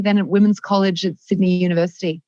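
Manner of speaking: ordinary delivery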